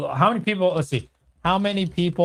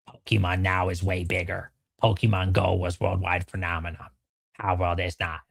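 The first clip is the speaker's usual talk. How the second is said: lower, softer deadpan drone; mock deadpan read; 11 semitones lower, 5 dB quieter than usual. Streamer reading a viewer's chat aloud, in a low mocking drone. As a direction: read the comment like it's beneath you.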